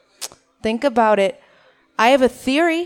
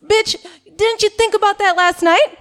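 high, loud exasperated inner voice; sassy mock outrage in a high voice; inner rant; 9 semitones higher, 4 dB louder than usual. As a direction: let the real annoyance burst out, higher and louder. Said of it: "A K A".

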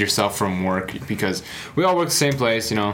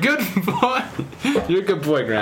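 praising a dog; 9 semitones higher than usual. Retold or imitated. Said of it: "I was like".

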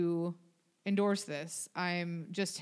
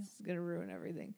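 rushed and quiet, holding in a sneeze; fighting off a sneeze; about to sneeze; 3 semitones lower, 8 dB quieter, much faster than usual. Real, not acted. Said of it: "I'm gonna sneeze".

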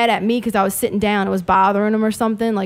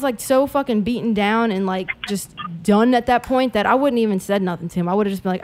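ordinary talk, nothing marked

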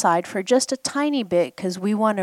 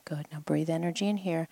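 whisper; 8 dB quieter than usual. Real, not acted. Guiding a breathing exercise.

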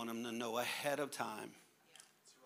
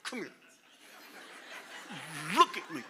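loud stern come here; stern parent; 2 semitones higher, 4 dB louder than usual. Pretend. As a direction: make the kid look at you. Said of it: "You're gonna".

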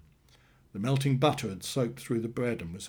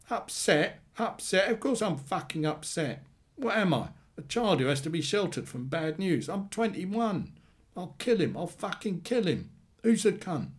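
high-pitched, indignant brother in a row; acted fury with raised pitch; angry outburst; 8 semitones higher than usual. A narrator's reading. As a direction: throw the word back, outraged, then rage on. Acted sibling quarrel, brother enraged.

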